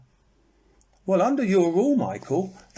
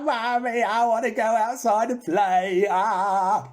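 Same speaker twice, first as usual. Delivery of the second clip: high, whining mock wail; hammed-up tantrum sobbing, pitched high; pretend crying child; 7 semitones higher, 3 dB louder than usual. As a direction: wail to mummy like a thwarted little kid. Acts a crying child for "cry.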